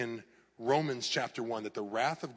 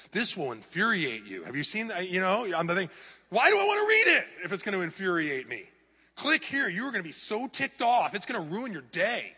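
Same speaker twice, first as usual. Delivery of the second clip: higher, louder, breathless headline voice; mock clickbait hype; 6 semitones higher, 5 dB louder than usual. Pastor mocks clickbait headlines for congregation, hammed-up hype. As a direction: ham up the outrage bait.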